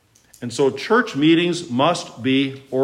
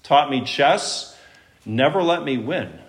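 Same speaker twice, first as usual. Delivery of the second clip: ordinary delivery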